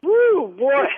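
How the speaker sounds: real burst of relief; relieved whoop. Real, not acted. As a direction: whoop out the tension. Relief after prank reveal.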